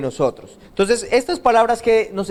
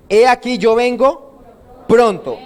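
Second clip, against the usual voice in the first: proclaiming as Jesus; 5 semitones higher, 5 dB louder, slower than usual. Acted. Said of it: "Jesús está diciendo entonces".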